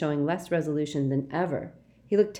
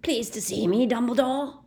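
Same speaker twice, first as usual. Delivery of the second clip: rasping, high mocking bark; 7 semitones higher than usual. A narrator's reading. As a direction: rasp it with a sneer. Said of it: "a rasping bark of a voice".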